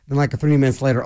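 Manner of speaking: ordinary delivery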